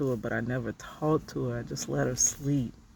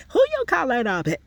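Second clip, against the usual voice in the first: high, loud sassy comeback; quoting a favorite sitcom line with attitude; sitcom character; 12 semitones higher, 5 dB louder than usual. Retold or imitated. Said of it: "the episode when she said".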